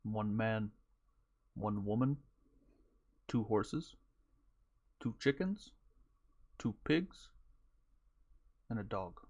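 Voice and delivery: slow, soft, spaced-out listing; lightly voiced storyteller's character. Injured man lists what he noticed to the cowboy, slow and soft. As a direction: count them off softly with long pauses.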